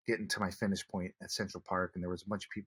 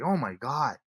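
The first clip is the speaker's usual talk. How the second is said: amazed; 6 semitones higher, 6 dB louder, slower than usual. Retold or imitated. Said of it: "she was like".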